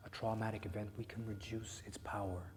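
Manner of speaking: hushed, gentle reassurance; soft whisper. Acted. Therapist quietly reaching out to Jack, hushed whisper. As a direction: whisper it close, like comfort.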